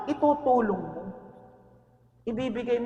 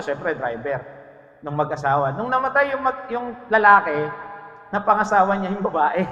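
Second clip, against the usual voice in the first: usual speaking voice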